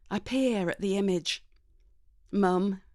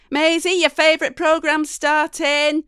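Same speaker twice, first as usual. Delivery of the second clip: raised, higher call up the stairs; Mum shouting; 8 semitones higher, 11 dB louder than usual. Acted. Mum calling daughter from downstairs, shouting up. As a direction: shout it up to the bedroom. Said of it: "Mum shouts up the stairs".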